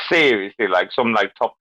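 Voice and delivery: usual speaking voice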